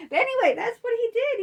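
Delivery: high, loud fed-up sigh-off; exasperated wrap-up. Real, not acted. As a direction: close it out in exasperation.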